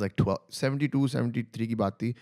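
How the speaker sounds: ordinary talk, nothing marked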